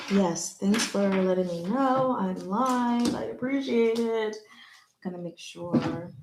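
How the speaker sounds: normal talking voice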